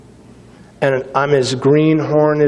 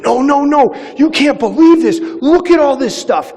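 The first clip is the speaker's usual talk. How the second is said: excited recruit; 10 semitones higher than usual. Retold or imitated. Recruit urging his officer to look, high and excited. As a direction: sound like you can't contain the discovery.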